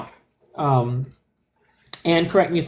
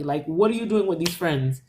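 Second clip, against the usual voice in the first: ordinary talk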